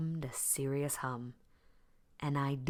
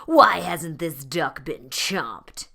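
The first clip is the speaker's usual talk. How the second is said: loud, slow menacing growl; lions growling; 10 dB louder, slower than usual. A narrator's reading. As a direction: demand it of the lion like a bully. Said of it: "they growled".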